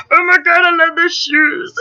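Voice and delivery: loud, high put-on gushing; excited shopper voice. Excited shopper spotting shoes, silly squawky voice. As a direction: squawk like an excited little old man. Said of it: "like, excited when you're shopping".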